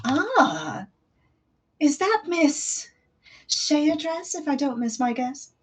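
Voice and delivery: high, slow, charming host; smiling voice. Acted. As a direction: purr it with a smile in the voice. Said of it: "hear the smile in his voice".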